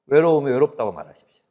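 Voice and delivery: ordinary talk, nothing marked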